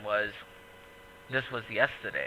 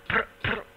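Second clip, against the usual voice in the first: gunfire noises; 11 semitones higher, 4 dB louder than usual. Retold or imitated. Gun noise imitation.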